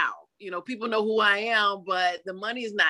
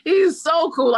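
normal talking voice

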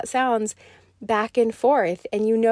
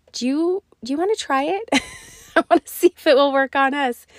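higher, bright playful ask breaking into a laugh; wife giggling as she asks her husband to try; playful laughing invite; 5 semitones higher, 4 dB louder than usual. Retold or imitated. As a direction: tease him into trying it, laughing.

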